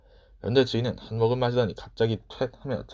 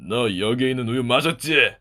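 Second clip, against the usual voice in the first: slow, louder accusing growl; angry accusation; 5 dB louder than usual. A narrator's reading. Master suddenly accusing Miru of drinking the milk, angry. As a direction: pin the boy with a fierce accusation. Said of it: "무섭게 화를 내기 시작했어요".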